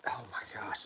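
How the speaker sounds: normal talking voice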